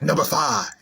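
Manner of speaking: lightly imitated cartoon villain voice; a hint of a put-on Grinch growl. Vlogger recalling his filter video, lightly imitated Grinch. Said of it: "I sounded like the Grinch".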